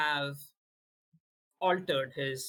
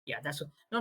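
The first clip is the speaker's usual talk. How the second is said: ordinary delivery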